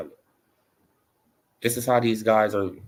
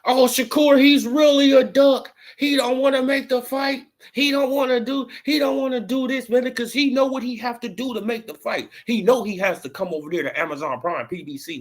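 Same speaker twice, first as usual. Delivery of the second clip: high, loud mock whine; mocking rival fans; 12 semitones higher, 3 dB louder than usual. Imitating Tank fans bashing Shakur, high mock whine. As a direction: ham up the rival fans' complaints. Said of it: "What would they say?".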